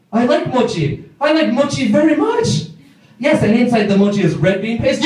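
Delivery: put-on high-pitched Japanese-accented English, mounting to a squeal; excited Japanese coworker. Excited Japanese coworker presents gift.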